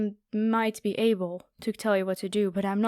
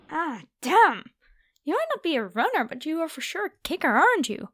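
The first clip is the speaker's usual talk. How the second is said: quick, higher, amused after a kick; stung and mocking; 5 semitones higher than usual. Vampire just kicked by the human, quick and higher. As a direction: shake off the kick with a taunt.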